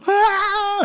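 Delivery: very high, held wail; mock funeral wail. Shown as a demonstration. Imitating Batak funeral wailing. (ratapan tiruan melengking)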